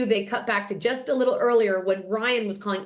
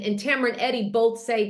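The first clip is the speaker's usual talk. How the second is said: ordinary delivery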